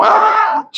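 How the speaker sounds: high squealed ah of delight; mock swoon. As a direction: squeal like a smitten wife. Wife swooning at compliment.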